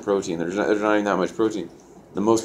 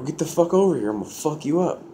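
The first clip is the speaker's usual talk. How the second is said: raised pitch, mock fighting threat; re-enacted angry challenge; 9 semitones higher than usual. Teller straps shoes up and calls inmate out, acted threat. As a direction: call him out for a fight, retold.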